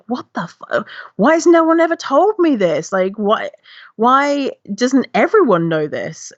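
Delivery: high, outraged why; how did nobody tell me; indignant amazement. Retold or imitated. Retells outrage at hidden information.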